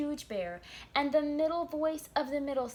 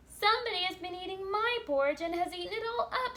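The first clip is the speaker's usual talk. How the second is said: high, quick little bear; little wee bear voice; 9 semitones higher, 3 dB louder, faster than usual. Acted. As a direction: squeak it out like the tiny bear. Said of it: "in his little small wee voice".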